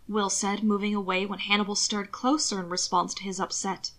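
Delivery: ordinary delivery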